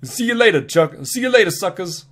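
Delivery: high-pitched, badly faked Brooklyn; bad Brooklyn accent. Scout says goodbye to viewers, bad Brooklyn accent. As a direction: sign off in a high mock Brooklyn voice. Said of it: "I can't do a Brooklyn accent".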